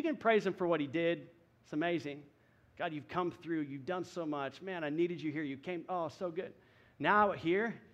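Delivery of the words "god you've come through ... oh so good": mock prayer. Shown as a demonstration. Voicing a thankful prayer.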